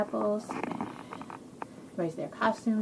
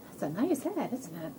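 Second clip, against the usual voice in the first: ordinary delivery, nothing marked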